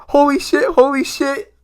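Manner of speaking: high, repeated disbelief; stunned exclaim. Retold or imitated. Fans stunned by the twist, high and repeated. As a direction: say it twice, floored.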